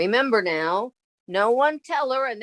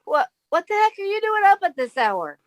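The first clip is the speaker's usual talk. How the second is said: much higher, surprised outburst; startled; 10 semitones higher than usual. Acted. Woman startled to find the maestro up this late, high and surprised. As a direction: shoot up in pitch at the late visitor.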